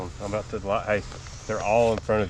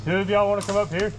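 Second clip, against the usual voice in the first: raised voice across the field; calling out; 8 semitones higher, 5 dB louder than usual. Real, not acted. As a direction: call out to teammates far away.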